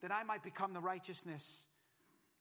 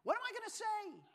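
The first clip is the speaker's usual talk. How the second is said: put-on New York accent; 12 semitones higher than usual. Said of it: "He probably said it with a New York accent".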